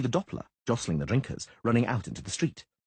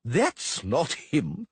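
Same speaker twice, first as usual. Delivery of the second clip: bellow; 5 semitones higher, much slower than usual. Acted.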